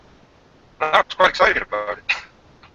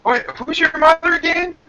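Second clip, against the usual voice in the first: high-pitched joking confusion; mock puzzled question; 13 semitones higher than usual. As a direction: play baffled, voice pitched way up. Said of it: "I'm like".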